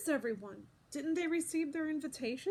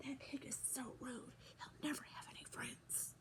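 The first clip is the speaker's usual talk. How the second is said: hushed gossiping whisper; 15 dB quieter than usual. A narrator's reading. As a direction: whisper it behind his back. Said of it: "Arnold whispering".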